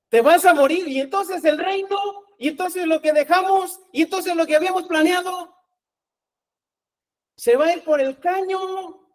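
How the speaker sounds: high-pitched mock panic, question after question; acted dismay of apostles. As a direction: act out alarmed apostles seeing plans collapse. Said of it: "cómo creen que estuvieron los apóstoles".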